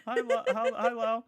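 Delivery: high, loud cartoon hello; high-pitched roll-call greeting; SpongeBob. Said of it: "I'm gonna pull a SpongeBob".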